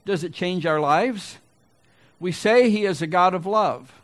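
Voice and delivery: challenging preacher's call and probing question; preaching. Real, not acted. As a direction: declare each claim high and loud, then drop for the question.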